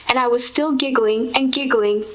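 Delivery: usual speaking voice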